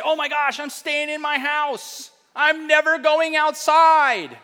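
high, drawn-out, hammed-up alarm; high mock-frightened wail, slowing right down; mock panic. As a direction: overdo the panicked refusal, building to a loud finish.

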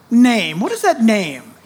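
usual speaking voice

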